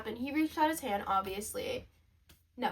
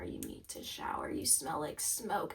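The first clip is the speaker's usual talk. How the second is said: harsh whisper, soft; mom's whispered scolding; 9 semitones lower, 13 dB quieter than usual. Retold or imitated. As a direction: hiss the order under your breath. Mom scolding quietly.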